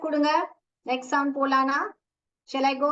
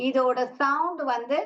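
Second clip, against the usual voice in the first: usual speaking voice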